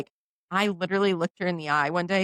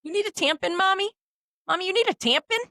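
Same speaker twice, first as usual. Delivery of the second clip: put-on toddler voice; 11 semitones higher than usual. Daughter quoted, high voice.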